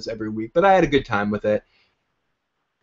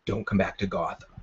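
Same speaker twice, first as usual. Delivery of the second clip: low hushed threat, much softer; hushed warning; 5 semitones lower, 9 dB quieter than usual. Retold or imitated. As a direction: warn him quietly, barely above a breath. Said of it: "Gordon whispers to him".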